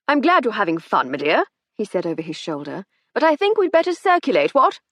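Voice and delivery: put-on jovial old gent. A narrator's reading. Sir Hector leaving.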